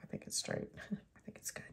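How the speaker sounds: unsure whispered aside to herself. Real, not acted. Whispering to herself as she checks the card is straight. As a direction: breathe it out, half to herself.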